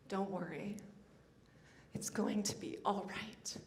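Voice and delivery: soft, hushed comfort; gentle reassurance. Acted. Grandmother comforting the grandson through the medium, softly. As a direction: say it softly, like a grandmother soothing a child.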